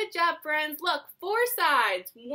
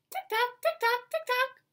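high, even tick-tock; high-pitched clockwork rhythm; ticking clock; 9 semitones higher than usual. Acted. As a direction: tick along crisply like a clock. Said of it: "He can sound like a clock".

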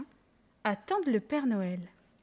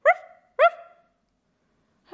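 loud woofing bark; dog barking; 10 dB louder than usual. A narrator's reading. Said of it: "le chien aboie".

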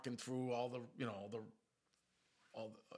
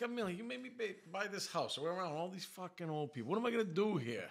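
mock complaint; 9 semitones higher, 3 dB louder than usual. Complaining to wife about old neighbours.

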